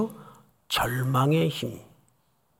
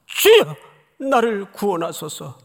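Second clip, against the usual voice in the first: loud, much higher cry for help; drowning Peter crying out, loud and high; desperate cry; 4 semitones higher, 5 dB louder than usual. Retold or imitated. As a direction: cry out to the Lord like a drowning man. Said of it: "죽어가는 마당에".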